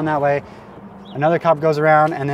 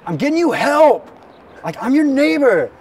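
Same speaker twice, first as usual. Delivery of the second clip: high, loud, stretched-out mock yell; re-enacted indignant holler; imitated yelling; 10 semitones higher, 5 dB louder, slower than usual. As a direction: yell it slowly, pitched way up. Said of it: "yelling at me".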